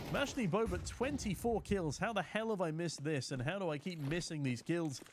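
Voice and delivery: ordinary talk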